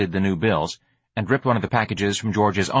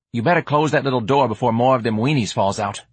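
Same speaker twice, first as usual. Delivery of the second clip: higher, casual street voice; pitched up, offhand warning; streetwise hustler, warning; 4 semitones higher than usual. Acted. George warns Ignatius about the weenies, street-kid voice.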